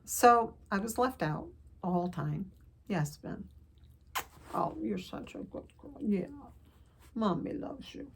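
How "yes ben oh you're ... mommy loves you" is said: cooing to pet. Real, not acted. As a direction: coo softly to your little pet. Cooing to her pet dog.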